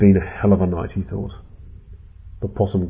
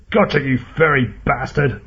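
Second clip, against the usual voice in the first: raised, higher-pitched gloating shout; triumphant shout; 5 semitones higher, 4 dB louder than usual. A narrator's reading. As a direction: shout it at the enemy you caught. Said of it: "Ed shouted".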